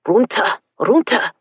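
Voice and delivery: loud German command; barked order. Acted. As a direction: bark it at them to get down. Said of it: "one of the men barked in German".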